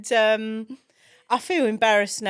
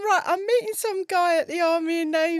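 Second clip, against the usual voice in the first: laughing; 10 semitones higher than usual. Retold or imitated. Retelling what she told husband.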